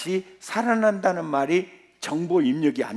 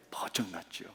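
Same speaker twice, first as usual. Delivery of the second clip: 번쩍 났죠 breathed in a whisper; 9 semitones lower, 15 dB quieter than usual. Real, not acted. 회중에게 답하는 설교자, 속삭임. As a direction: drop to a whisper for 번쩍 났죠. Answering own question softly.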